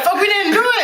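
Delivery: hammed-up innocent child, laughing; pleading kid. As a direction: kids begging mom not to punish them, high and laughing. Kids protesting to mom.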